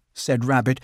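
ordinary delivery, nothing marked

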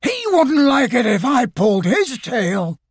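high, bouncy, put-out voice; a cartoon tiger, offended; indignant Tigger.

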